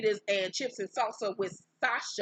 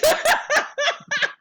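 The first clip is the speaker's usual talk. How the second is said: high shrieking laugh; 8 dB louder than usual. Real, not acted. Laughs at Scott's denial.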